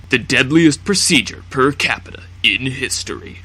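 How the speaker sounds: dramatic trailer-style announcer voice; clickbait narrator. Acted. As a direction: sell it like a sensational video title. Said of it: "I had to use my clickbait narrator voice".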